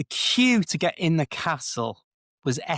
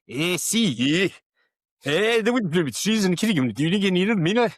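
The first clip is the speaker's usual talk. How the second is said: garbled, high-pitched Scottish local, barely intelligible; mock an incomprehensibly thick Scottish accent; broad Scottish accent; 5 semitones higher, 3 dB louder than usual. Edinburgh local giving a lost visitor Starbucks directions, in broad garbled Scottish. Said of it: "he was as broad an accent".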